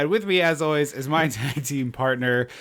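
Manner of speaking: ordinary talk, nothing marked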